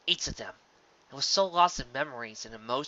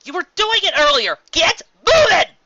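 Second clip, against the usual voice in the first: frustrated yelling; 16 dB louder than usual. Acted. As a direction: scream at the stubborn rose. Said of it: "Des growled with frustration".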